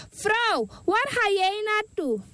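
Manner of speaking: high, calling husband's voice; husband calling to his wife as she hurries away. Acted. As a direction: call after her as the worried husband. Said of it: "hij riep tot haar en zei".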